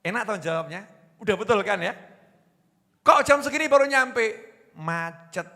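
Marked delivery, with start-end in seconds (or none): 3.0-4.3 scolding wife. Retold or imitated. Wife scolds late husband. 4.8-5.5 much softer, small husband's excuse. Retold or imitated.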